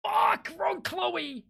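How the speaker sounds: high, drawn-out dismay; dismayed cry. Real, not acted. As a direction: wail at the wrong choice. Picked the wrong answer.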